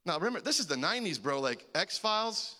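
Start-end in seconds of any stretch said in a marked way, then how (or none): none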